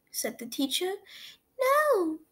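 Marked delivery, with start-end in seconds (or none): 1.6-2.2 put-on child voice. A narrator's reading. Child answers.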